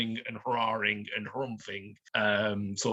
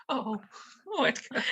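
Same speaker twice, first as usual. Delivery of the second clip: lovestruck sigh; 13 semitones higher than usual. Acted. Barmaid sighs over Edgar.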